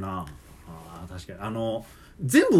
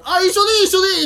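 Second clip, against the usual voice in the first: brushing it off, higher and louder; impatient customer; 6 dB louder, much faster than usual. Retold or imitated. Customer dismissing the clerk's bag question, impatient and loud. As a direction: wave the clerk off, twice over.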